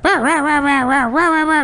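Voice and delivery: high yappy barks; imitated dog barking. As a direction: yap like a small excited dog. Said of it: "she'd be like".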